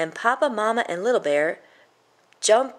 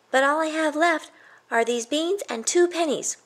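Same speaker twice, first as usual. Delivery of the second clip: put-on much higher boyish voice; little boy pleading; 10 semitones higher than usual. Jack telling the troll he only has beans, high and childlike. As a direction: plead like a small child with empty pockets.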